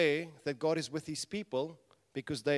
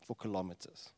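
ordinary delivery

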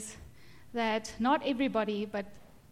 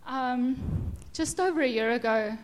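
normal talking voice

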